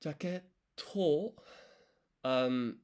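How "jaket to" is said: Jaket to with a held beat of silence before a stressed to; Japanese pronunciation. Shown as a demonstration. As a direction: pause after Jaket, then punch the T of to. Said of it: "you put extra emphasis on the T".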